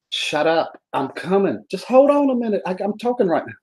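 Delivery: high-pitched squawk of impatience; mock exasperated. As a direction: fend off a nagging imaginary caller. Scolding the donut that calls him.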